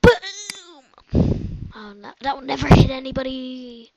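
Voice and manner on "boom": high loud boom; explosive game shout; excited shout. Real, not acted. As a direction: cry out the hit. Shooting an arrow.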